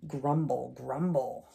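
ordinary delivery, nothing marked

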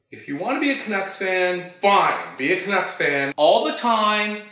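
worked-up rant, high and loud; angry rant. Acted. Telling a Canucks fan to shut up, angry rant. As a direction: build to an exasperated shout.

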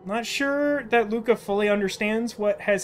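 put-on gentle, earnest child character; wistful child. Child updating absent Dad and understanding why he left, gently wistful. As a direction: speak gently to a father who is gone.

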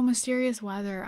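normal talking voice

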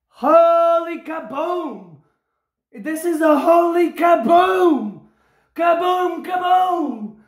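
triumphant shouting. Real, not acted. Winner celebrates a huge hit with viewers, triumphant shouting. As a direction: holler it out, stretching the big word.

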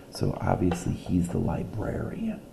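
usual speaking voice

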